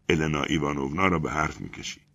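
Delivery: ordinary delivery, nothing marked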